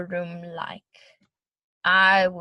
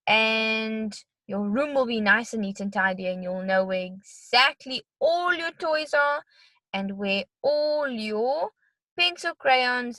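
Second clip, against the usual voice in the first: normal talking voice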